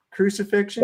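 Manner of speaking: high rising question, acted out; mock guard's brisk inquiry, voice lifted; Roman guard. Retelling a film's guard sorting prisoners, high questioning voice. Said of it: "the Roman guards going".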